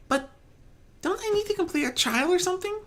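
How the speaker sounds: high, quick confused question; a shy pony expecting a test; puzzled timid pony. Acted. Fluttershy puzzled.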